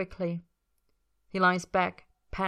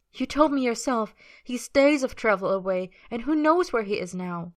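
urgent pleading; 5 semitones higher, 3 dB louder than usual. A narrator's reading. Melody argues, pinning him down.

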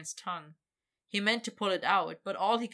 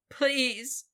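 higher, louder desperate plea; desperate cry; 7 semitones higher, 7 dB louder than usual. Acted. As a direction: beg desperately. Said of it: "he cried desperately".

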